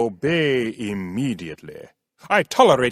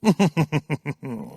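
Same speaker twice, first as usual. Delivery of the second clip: regal chuckle; 2 semitones higher than usual. Acted. King laughs at question.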